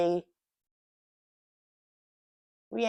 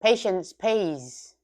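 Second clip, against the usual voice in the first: drawn-out, loud and slow; slow proverb; 4 semitones higher, 13 dB louder, much slower than usual. Acted. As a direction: deliver it like a wise saying.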